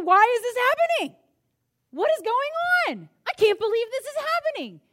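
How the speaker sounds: shouted high and loud, anguished; a pastor demonstrating a cathartic cry of frustration; cathartic yell. Shown as a demonstration. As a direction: let it all out in a full-throated yell. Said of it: "sometimes you just need to yell".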